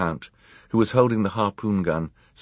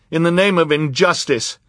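loud plea; 10 semitones higher, 7 dB louder than usual. A narrator's reading. Jerome pleads.

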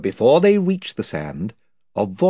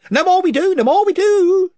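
higher eager followers; chorus of voices; 9 dB louder than usual. Acted. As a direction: cheer on your chief. Chorus chimes in.

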